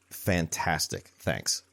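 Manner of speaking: slow, softly deadpan mock gratitude, dropping low on the last word; unimpressed sarcasm. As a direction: give thanks you clearly don't mean. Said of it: "And I was not thrilled".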